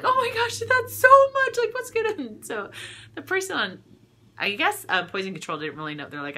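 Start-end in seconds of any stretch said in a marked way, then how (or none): 0.0-2.3 very high, loud mock panic squeal; mock freaking out. Mom calling about the lemon bottle, squealing in mock panic. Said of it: "freaking out".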